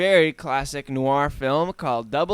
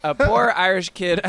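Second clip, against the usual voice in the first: louder put-on Irish accent; 4 semitones higher, 6 dB louder than usual. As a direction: repeat it with an Irish lilt. Repeats phrase in Irish accent.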